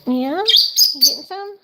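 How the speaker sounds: high cooing to the birds; pet talk. Real, not acted. Owner cooing at birds grabbing their treat in high pet talk. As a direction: coo up high at them as they eat.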